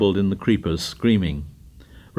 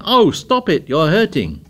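very high, loud squealing protest; pained squeal; 6 semitones higher, 4 dB louder than usual. A narrator's reading. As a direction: squeal as play turns to pain. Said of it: "squealed in mock terror, then in real pain".

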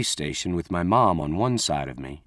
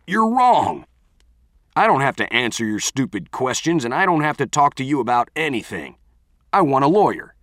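put-on furious teen yelling back; angry shouting; 5 semitones higher, 5 dB louder than usual. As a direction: shout it in defiance. Bo shouting at police.

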